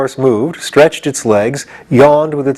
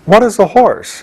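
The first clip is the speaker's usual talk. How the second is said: demanding question; 9 semitones higher than usual. A narrator's reading. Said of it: "demanded Jack".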